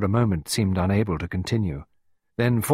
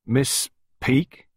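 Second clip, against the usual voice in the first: "Miss Peake" raised into a question; querying; 6 semitones higher, 4 dB louder than usual. A narrator's reading. Costello looking at the gardener, querying "Miss Peake". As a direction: query the name "Miss Peake" with a rising end. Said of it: "he queried".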